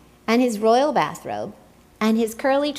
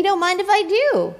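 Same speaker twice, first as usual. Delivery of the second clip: cheerful Tacky; 11 semitones higher, 4 dB louder than usual. A narrator's reading. Tacky accepts happily.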